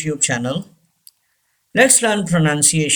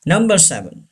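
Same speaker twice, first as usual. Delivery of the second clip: ordinary delivery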